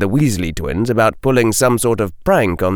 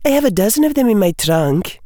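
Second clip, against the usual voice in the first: quick, high witch character voice; young witch; 11 semitones higher, faster than usual. A narrator's reading. Witch boasting to Harry, quick high character voice. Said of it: "the witch said".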